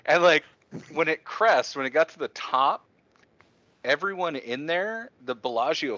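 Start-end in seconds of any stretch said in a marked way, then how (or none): none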